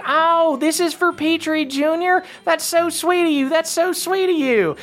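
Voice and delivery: high, squeaky and touched; high-pitched grateful elf. Acted. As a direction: gush high like a moved little elf. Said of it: "And he goes, like".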